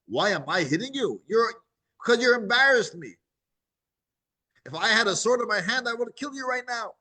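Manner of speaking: Bilam, humiliated, threatens the donkey; angry Bilam. Acted.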